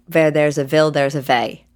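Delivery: put-on Russian accent, w said as v. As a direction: quote grandpa in a Russian accent. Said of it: "uh, in a Russian accent".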